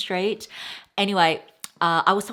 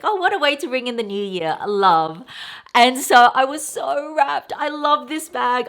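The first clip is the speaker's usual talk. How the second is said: much higher, louder thrilled squeal; delighted gush; 7 semitones higher, 7 dB louder than usual. Real, not acted. Thrilled about the husband's gift, excited gushing. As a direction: burst with delight over the gift.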